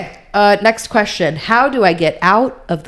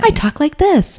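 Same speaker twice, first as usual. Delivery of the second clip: high, sugary-sweet mother voice; mom Duggar voice; 7 semitones higher, slower than usual. Retold or imitated. Sister mimicking a sweet-voiced TV mom to her cohost. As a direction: coo it like a doting mom. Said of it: "the mom Doogar is always like".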